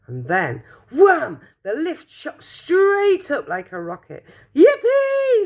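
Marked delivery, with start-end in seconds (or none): 4.5-5.5 very high, loud whoop; joyful shout. Acted. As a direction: whoop with glee as the lift rockets. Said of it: "shouted Grandpa Joe".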